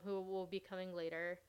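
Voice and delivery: ordinary talk, nothing marked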